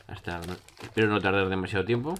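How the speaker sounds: ordinary talk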